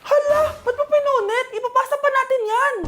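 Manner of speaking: high, alarmed cry; shocked alarm. Acted. Classmate panicking over torn research, high alarm.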